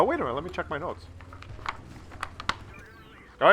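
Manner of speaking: ordinary delivery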